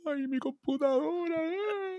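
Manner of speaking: high, drawn-out mock wailing; llanto fingido. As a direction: wail it slowly, badly faking tears. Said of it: "cuando yo te llamaba llorando". (lloriqueo exagerado, agudo y arrastrado)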